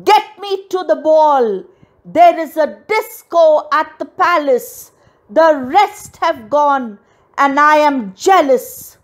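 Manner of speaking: high, insistent tantrum shout; high, jealous outburst; shouted demand. A narrator's reading. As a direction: demand it at the top of your voice. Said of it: "and shouted".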